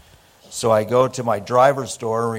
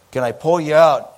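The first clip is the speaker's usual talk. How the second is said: loud, higher-pitched offer of help; raised voice, hollering a question; calling out; 6 semitones higher, 7 dB louder than usual. Retold or imitated. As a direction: call out to a stranded driver. Calling to a stranded driver.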